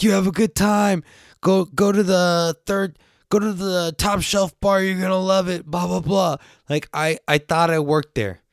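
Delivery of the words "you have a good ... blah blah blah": higher, upbeat fake-staff patter; hamming up a Topgolf worker to guests; mock employee. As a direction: play the cheery valet host. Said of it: "I start acting like I work there".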